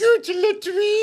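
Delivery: high mock shout, loud. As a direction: yell it like a holiday advert. Mocking shout of resort name.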